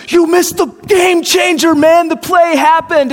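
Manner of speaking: hammed-up friend shouting slowly about the play; excited shout. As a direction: yell it like the room just exploded. Friend yelling about missed play.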